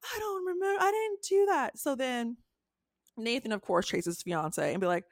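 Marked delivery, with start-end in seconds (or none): 0.0-1.8 high, whiny mock denial; dramatic denial. Fiancee running out of Thanksgiving, whiny denial. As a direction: play the fake victim, pitched way up. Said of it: "runs out in dramatic fashion".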